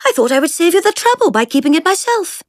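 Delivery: put-on teasing upper-class girl. A narrator's reading. Jemima's drawling voice.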